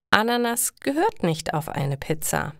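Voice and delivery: plain reading voice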